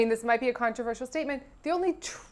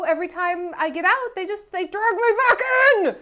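loud, higher, dramatic outburst; hammed-up movie quote; 6 semitones higher, 5 dB louder than usual. Quoting a mob film to co-host, hammed-up. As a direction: build to an exasperated mock wail.

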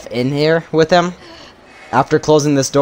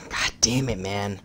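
much softer, low muttered curse; muttered frustration; 8 semitones lower, 11 dB quieter than usual. Real, not acted. Streamer cursing his wasted shots, soft low mutter. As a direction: grumble it to yourself. Frustrated after wasting ammo.

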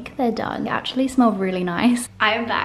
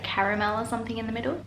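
normal talking voice

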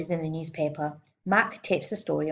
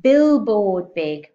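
much louder, slow and expansive; grand and slow; 3 semitones higher, 11 dB louder, much slower than usual. A narrator's reading. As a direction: stretch it out like arms flung wide. Girl spreading arms wide.

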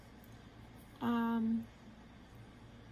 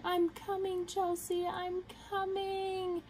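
higher, mock mom calling from upstairs; worried mom calling; 8 semitones higher, slower than usual. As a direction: lightly imitate mom calling out as she runs down. Mom running to help at midnight.

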